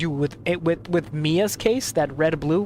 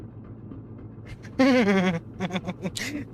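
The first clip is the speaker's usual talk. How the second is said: burst of laughter; 4 semitones higher, 3 dB quieter than usual. Real, not acted. Laughing at sent photo.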